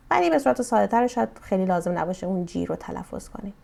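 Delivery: ordinary talk, nothing marked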